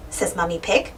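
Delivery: ordinary delivery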